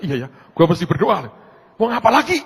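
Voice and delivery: high, shouted resignation; exasperated giving in. Retold or imitated. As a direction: throw it out loud and high, out of options. Acts out giving in, loudly.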